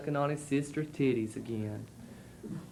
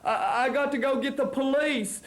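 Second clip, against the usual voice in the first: put-on flustered old man; 9 semitones higher than usual. A narrator's reading. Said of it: "he said, ashamed".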